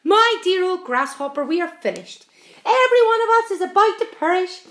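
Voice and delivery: high, loud, theatrical despair; doom-laden cry. A narrator's reading. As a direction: earthworm proclaiming doom. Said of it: "cried the earthworm".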